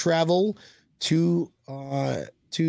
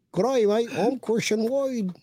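mock Australian accent; 7 semitones higher than usual. Host repeating his accent for co-host on request, high mock Australian. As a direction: stretch the vowels in a high Australian twang. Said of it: "do your Australian accent too".

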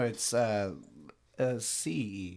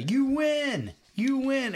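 screaming to the sky; 13 semitones higher, much slower than usual. Retold or imitated. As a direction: throw it up to the heavens, beaten. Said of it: "he just screams up to the sky".

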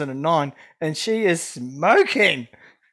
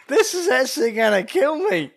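laughing; 8 semitones higher than usual. Retold or imitated. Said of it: "you kind of look at it like".

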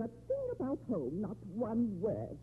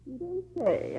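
normal talking voice

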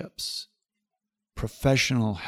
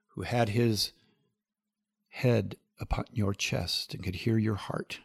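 intimate closing prayer. Acted. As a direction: pray gently for a grieving friend.

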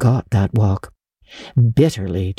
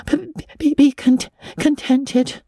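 higher, stuttering broken syllables, slowing; teeth chattering; 12 semitones higher than usual. A narrator's reading. Anne promising Marilla, stuttering with chattering teeth. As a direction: chatter through each word, terrified. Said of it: "chattered Anne".

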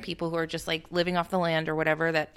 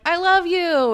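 mock tender line; 11 semitones higher, 9 dB louder, slower than usual. Contrast to avenge me.

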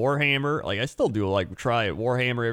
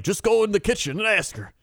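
put-on old man; 9 semitones higher, 3 dB louder than usual. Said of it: "he's like".

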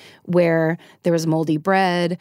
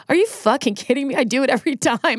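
higher-pitched retold disbelief; mock incredulous sister; 6 semitones higher than usual. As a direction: imitate an exasperated sister, pitched up. Sister's incredulous reply acted out.

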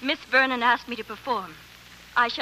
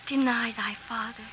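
Juliet recitation; 8 dB quieter than usual. Acted. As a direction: recite Juliet's balcony speech softly. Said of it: "leave this one light on my face".

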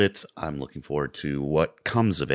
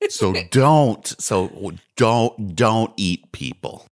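mock scolding; 6 semitones higher, 9 dB louder, much slower than usual. Scolding the cannibal.

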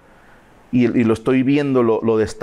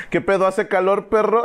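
a lightly voiced, higher, teasing "¿Qué pedo?"; a playful mock greeting to the beyond; joking phone greeting; 8 semitones higher than usual. Answering a call from dad's old number, lightly mocking.

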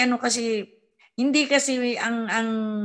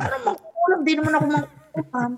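mock indignant; 6 semitones higher than usual. Retold indignant reply to accusation.

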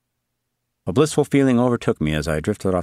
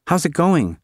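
put-on high, nervous girl's question; calling out, scared for him; high, anxious call; trepid; 9 semitones higher than usual.

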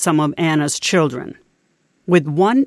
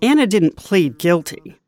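ordinary talk

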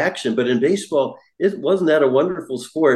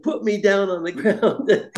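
high and slow, laughing through the words; laughing plea; 8 semitones higher than usual. Retold or imitated. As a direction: beg to be put down, half laughing. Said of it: "And I said".

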